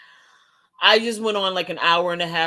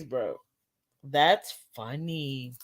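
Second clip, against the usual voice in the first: normal talking voice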